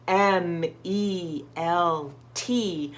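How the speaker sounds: very slow letter-by-letter spelling; slow careful spelling; spelling out a word. Shown as a demonstration. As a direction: spell each letter out slowly. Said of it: "He spelled everything".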